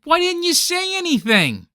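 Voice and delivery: high-pitched indignant question; indignant blame. Acted. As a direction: demand it of the bystander in dismay. Blaming the bystander in panic.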